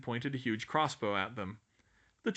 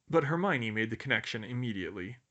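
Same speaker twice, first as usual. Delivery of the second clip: ordinary delivery, nothing marked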